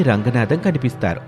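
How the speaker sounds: usual speaking voice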